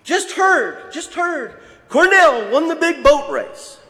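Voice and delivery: high, loud, slowing at the end; thrilled herald of a boat race; excited announcement. Retold or imitated. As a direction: proclaim the news like a triumph. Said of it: "comes running in with big news".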